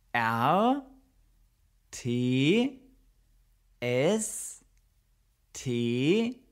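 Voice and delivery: G E B U R T S T A G S T O R T E, one letter at a time with pauses; spelling it out. Shown as a demonstration. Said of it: "teacher spells Geburtstagstorte". (Geburtstagstorte langsam buchstabiert)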